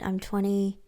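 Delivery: whispered to herself. Real, not acted. Counting her age aloud to her cohost, whispered. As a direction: mutter the sum under your breath.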